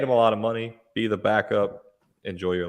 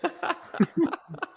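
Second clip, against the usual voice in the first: high-pitched laugh. Real, not acted. Laughs at the rant.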